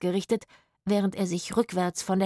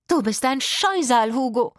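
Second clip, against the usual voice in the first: higher, louder scolding cry; angry shout; 5 semitones higher, 5 dB louder than usual. A narrator's reading. Woman yelling at Hugo in a barn quarrel, angry shout. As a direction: shriek it at him like a furious woman. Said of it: "schrie eine der Frauen".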